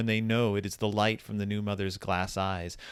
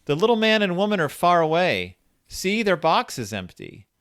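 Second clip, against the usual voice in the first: put-on singsong girl voice; 6 semitones higher than usual. A narrator's reading. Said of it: "the girl sings out".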